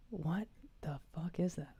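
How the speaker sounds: hushed, stunned whisper. Real, not acted. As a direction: breathe it out in disbelief. Whispered reaction to the drink.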